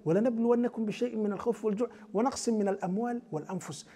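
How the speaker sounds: quick chanted recitation of the verse; Quran recitation. Acted. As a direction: recite it in a rapid, lifted chant. Quoting Quran in sermon.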